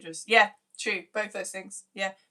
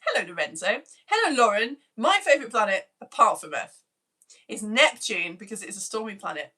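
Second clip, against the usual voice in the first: ordinary delivery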